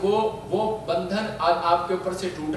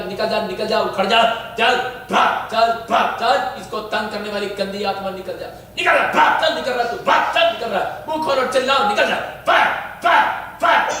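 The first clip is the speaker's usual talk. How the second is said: forceful repeated shouts of command; exorcism commands; 5 semitones higher, 6 dB louder than usual. Real, not acted. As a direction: drive the spirit out, building to bursts. Commands an evil spirit out. (भूत भगाने का ज़ोरदार आदेश)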